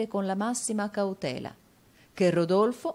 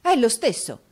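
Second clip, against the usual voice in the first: loud, high cry of recognition; agitated exclamation; 6 semitones higher, 8 dB louder than usual. A narrator's reading. Newman recognising the dreaded name, agitated exclamation. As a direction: burst out with it. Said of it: "esclamò Newman".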